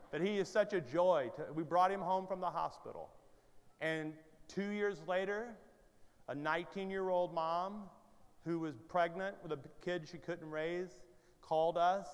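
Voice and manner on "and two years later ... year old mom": ordinary talk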